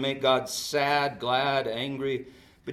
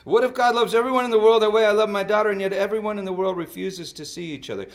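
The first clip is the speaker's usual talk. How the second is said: loud, raised-pitch climactic question; impassioned preaching; 8 semitones higher, 12 dB louder than usual. Real, not acted. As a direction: drive it to a shouted peak, then fall away.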